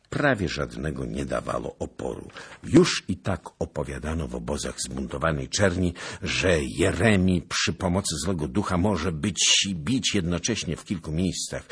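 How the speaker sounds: usual speaking voice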